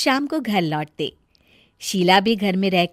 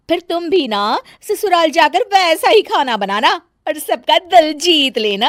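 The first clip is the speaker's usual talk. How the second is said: high loud showing off; boastful bahu; 7 semitones higher, 7 dB louder than usual. Acted. Bahu boasting to Sonam about cooking Chinese, loud high voice. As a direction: brag about her cooking.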